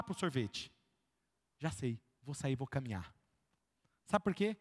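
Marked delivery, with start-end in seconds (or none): 1.6-3.1 quiet resolve. Retold or imitated. Acted good choice self-talk.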